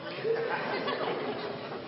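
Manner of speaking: high, breathy mock gasp; shocked gasp. Preacher imitating Pharisees gasping at the insult, shocked. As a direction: gasp in horror.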